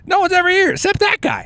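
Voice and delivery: very high, squawky sitcom impression; high-pitched yelping character voice; Charlie Day. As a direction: pitch it way up like an exasperated comic actor. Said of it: "That's my Charlie Day".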